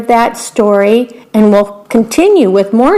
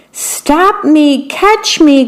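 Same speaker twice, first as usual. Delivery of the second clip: high, alarmed plea; worm crying for help; 7 semitones higher, slower than usual. A narrator's reading. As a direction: call out as the apple rolls away. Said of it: "cried the worm".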